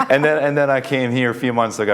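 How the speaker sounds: chuckling at his own joke; laughing. Real, not acted.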